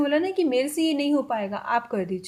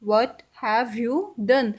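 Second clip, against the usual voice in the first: slow repeat of What have you done, a touch calmer; slow English model sentence; very slow teaching demo; much slower than usual. Shown as a demonstration. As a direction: repeat it very slowly. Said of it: "English में कैसे बोलेंगे?".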